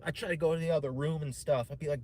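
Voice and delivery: normal talking voice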